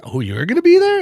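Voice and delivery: high, loud excited squeal. Retold or imitated. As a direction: shriek it like thrilled news. Repeated with bigger excitement.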